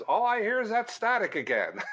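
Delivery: high-pitched call from another room. Retold or imitated. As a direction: imitate someone hollering through the house. Imitates friend calling from other room.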